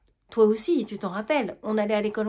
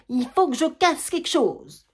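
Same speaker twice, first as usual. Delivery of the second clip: plus aigu, plus fort, lent et appuyé; rage qui éclate; 6 semitones higher, 4 dB louder than usual. Acted. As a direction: laisse exploser la colère, mot par mot. (Germaine, seule, laisse éclater sa rage, lente et forte)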